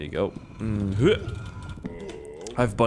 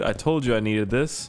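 ordinary delivery

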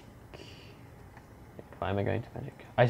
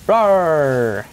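loud, higher monster roar; mock roar; 5 semitones higher, 12 dB louder than usual. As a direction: roar like a beast charging in. Charging into fight.

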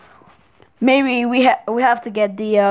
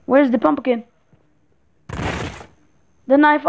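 usual speaking voice

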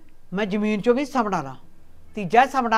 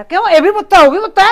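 higher, louder heated protest; 8 semitones higher, 9 dB louder than usual. Acted. Old mother to daughter-in-law, protesting loudly and higher that both are sons. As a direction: insist both are her sons, raised and loud.

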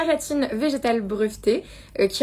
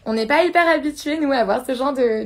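ordinary talk, nothing marked

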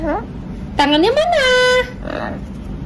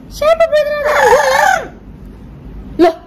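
put-on high baby talk to a pet; 12 semitones higher than usual.

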